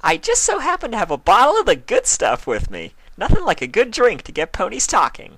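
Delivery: very high, bubbly pony voice; Pinkie Pie voice. A narrator's reading. Pinkie offering Spike a drink, high and bubbly. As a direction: offer it with glee.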